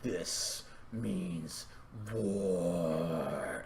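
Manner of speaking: very soft, deep, stretched-out words; low grim vow. Acted. Dash answers the plea for help, low grim vow. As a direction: sink low and let it linger. Same line redone low.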